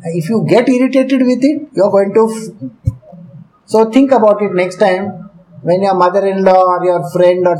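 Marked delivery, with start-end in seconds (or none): none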